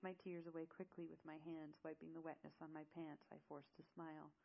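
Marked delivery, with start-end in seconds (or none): none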